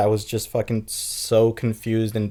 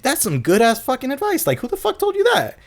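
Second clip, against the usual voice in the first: mock high amazed voice, much higher; playing the stunned friend, pitched way up. Friend stunned at his own advice, high disbelieving voice. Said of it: "He's like".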